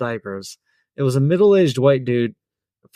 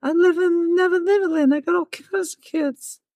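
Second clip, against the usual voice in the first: very high falsetto impression. Shown as a demonstration. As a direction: ramble in a tiny pop star falsetto.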